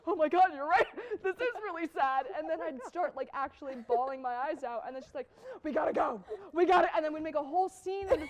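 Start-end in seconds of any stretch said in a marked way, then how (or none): 0.1-2.2 louder, hammed-up realization; mock upset kid convinced it's sad; dawning mock distress. Child suddenly agreeing with mom. 5.6-6.9 loud, imitated parental urgency; playfully retold panic to leave; mock urgent exit. Mom hurrying crying child out.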